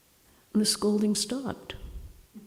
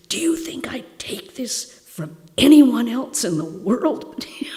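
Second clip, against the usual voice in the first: angry retort; 5 semitones higher, 6 dB louder than usual. Retold or imitated. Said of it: "I got mad".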